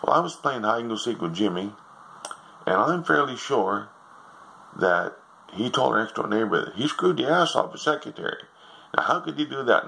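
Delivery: put-on high, innocent child's voice; a boy telling his mother at supper, high and innocent; little boy. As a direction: tell it like a wide-eyed kid. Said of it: "he said".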